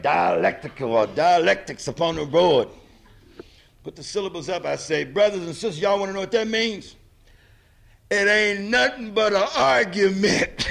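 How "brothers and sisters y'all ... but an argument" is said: lively crowd address. Retold or imitated. Retelling how he taught the party members, loud and lively. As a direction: rouse the room like a preacher. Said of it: "Huey, let me do it, man".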